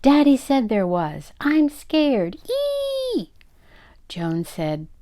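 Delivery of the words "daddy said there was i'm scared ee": loud squeal climbing into a shriek. Acted. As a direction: squeal in fright, ever higher. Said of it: "but continued to squeal".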